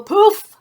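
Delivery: high, loud magic poof. Acted. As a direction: burst it out like a magic trick. Shapeshifter's magic happens.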